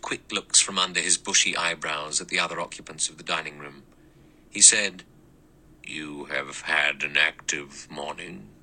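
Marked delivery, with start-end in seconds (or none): 5.8-8.5 faint malicious pleasure. Acted. The judge needling the others, slow and lower with faint malice. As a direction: savour their discomfort with a faint sneer. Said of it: "faint, malicious pleasure in his voice".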